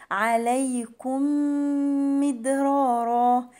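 "عليكم مدرارا" chanted slowly, humming through the joined m; merged meem. Shown as a demonstration. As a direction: hold one long nasal m linking "عليكم مدرارا". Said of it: "هنا هتبقى فيها إدغام". ("عليكم مدرارا" بإدغام الميم مع الغنة)

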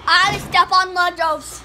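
loud, slow reading out; loud announcement. Real, not acted. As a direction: announce the dare, loudly. Reading out the dare.

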